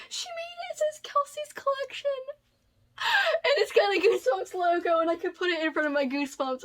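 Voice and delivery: high, tearful voice on the verge of crying; fighting back real tears; overwhelmed, tearful gratitude; choked-up crying. Real, not acted. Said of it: "I don't wanna cry".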